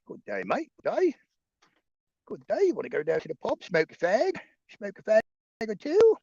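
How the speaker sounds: hammed-up Aussie bloke inviting to the pub; mock Aussie. Aussie bloke asking a mate to the pub, mock accent.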